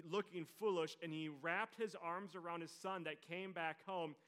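normal talking voice

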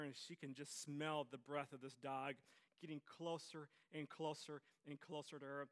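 ordinary talk, nothing marked

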